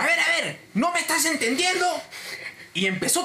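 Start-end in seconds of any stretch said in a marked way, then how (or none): 0.0-1.8 high, insistent challenge; exasperated customer. Retold or imitated. Cliente que empieza otra vez con el agente, exasperado. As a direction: demand it, pitched way up. Angry customer starts again, retold.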